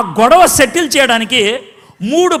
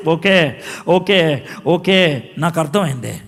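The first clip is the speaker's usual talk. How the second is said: calm reassurance; 6 semitones lower, slower than usual. Acted. God calming frightened Moses.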